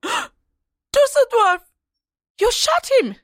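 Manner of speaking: high, shocked outcry; gasp, then cry out in horror; horrified cry. Acted. His soldier has just been shot.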